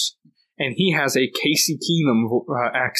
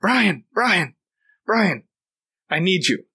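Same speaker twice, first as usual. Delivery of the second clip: urgent calling; 5 semitones higher, slower than usual. Retold or imitated. Friend running up, calling his name urgently. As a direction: hurry him over by name.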